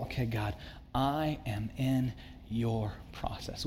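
soft, slow surrender to God; quiet prayer. Acted. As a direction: pray it slowly and humbly.

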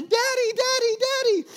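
put-on high excited little girl calling Daddy; child calling daddy. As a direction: call out like an excited toddler. Toddler calling running with scissors.

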